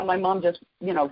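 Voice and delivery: ordinary talk, nothing marked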